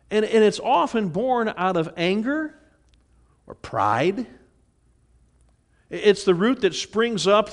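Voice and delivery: fervent sermon delivery; full-force preaching. Acted. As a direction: preach it out to the whole church. Preaching to congregation on bitterness.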